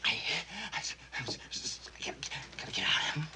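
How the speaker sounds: dazed, trapped whisper; breathless whisper. Acted. Trapped in crashed plane.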